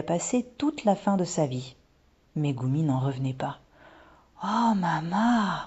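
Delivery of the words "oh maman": slow, higher, drawn-out wonder; amazed. A narrator's reading. Daughter to mother after the revelation, amazed. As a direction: stretch it out in little-girl awe.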